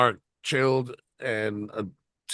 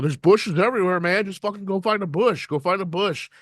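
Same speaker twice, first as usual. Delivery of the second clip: higher-pitched, egging-on buddy voice; mock pushy friend; 8 semitones higher than usual. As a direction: nag him in a high goofy voice to just go. Quoting Mark's urging.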